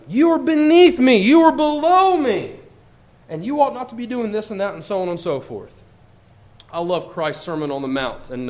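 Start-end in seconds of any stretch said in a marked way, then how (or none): 0.1-4.7 high, loud, looking-down voice; haughty put-down. Acted. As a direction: talk down to them as if they were dirt. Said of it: "we treat other people like that".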